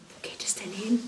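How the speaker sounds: hushed unvoiced whisper; a whispered question in a silent convent. A narrator's reading. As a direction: whisper it so nobody hears. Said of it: "flüsterte Claudette".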